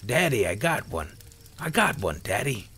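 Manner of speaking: put-on loud excited boy, pitch jumping up; excited yell. A narrator's reading. Young son announcing his first kill, excited yell. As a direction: yell it out like a thrilled kid. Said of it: "Sam yelled with great excitement".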